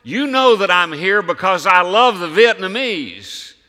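loud, pleading cry to the Lord; crying out in prayer. Retold or imitated. Missionary crying out to God in prayer, loud and pleading. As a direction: call out desperately on your knees. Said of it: "he said".

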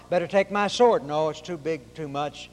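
normal talking voice

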